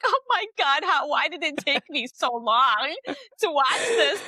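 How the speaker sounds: high, overwhelmed wail, played for laughs; mock tearful. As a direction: reenact being moved to tears, laughter creeping in. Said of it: "like, in tears".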